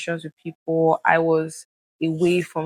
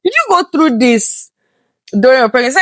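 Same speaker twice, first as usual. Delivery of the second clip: incredulous questioning; 6 semitones higher, 7 dB louder than usual. Retold or imitated. Acted urgent question to friend.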